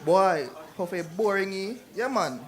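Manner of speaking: slow Jamaican patois line. Shown as a demonstration. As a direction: drawl it out like a Jamaican. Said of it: "For example".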